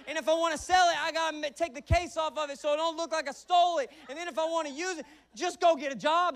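loud, high-pitched comic outburst; exasperated preaching rant. Acted. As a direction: yell it in mock outrage at the whole crowd.

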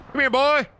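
high-pitched excited calling. Real, not acted. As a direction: squeal with delight.

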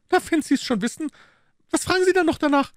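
very high, fast, aggrieved maid; indignant maid. Acted. Maid complaining to her mistress, very high and fast. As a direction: rattle off the complaint, put upon.

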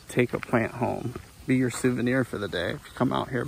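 ordinary talk, nothing marked

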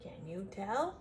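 ordinary delivery, nothing marked

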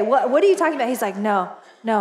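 ordinary talk

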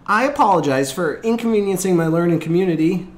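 high, loud schoolboy's formal apology; breathless recited apology. A narrator's reading. Late Asher apologizes to his class, high and breathless. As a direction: rattle off a standard apology, high and winded. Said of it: "still catching his breath".

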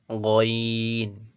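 গইন with the "ee" held very long; drawn-out. Shown as a demonstration. Teacher models for students, গইন drawn out long. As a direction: stretch গইন steadily before the n. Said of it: "এগুলো একটু করে টানবেন".

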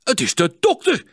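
high, loud startled cry; shocked exclamation. A narrator's reading. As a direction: blurt it out in shock. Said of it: "schrok Klinkhamer".

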